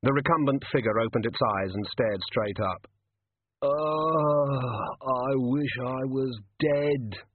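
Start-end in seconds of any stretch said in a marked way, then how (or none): 3.6-7.2 slow, pained moan. A narrator's reading. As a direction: drag it out like a dying man. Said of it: "it moaned".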